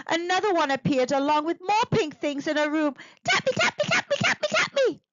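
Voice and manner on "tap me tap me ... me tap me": high squeaky little creature voice. Acted. Pink thing begging Eddie, high squeaky creature voice. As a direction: chirp it like a tiny gremlin. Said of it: "all saying".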